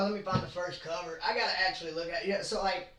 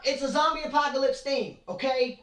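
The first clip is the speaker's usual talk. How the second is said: fired up and a bit louder; getting emotional; 7 semitones higher, 4 dB louder than usual. Real, not acted. Defending the genre to imagined commenters, fired up. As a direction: insist on it, worked up. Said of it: "Sorry, I'm getting emotional".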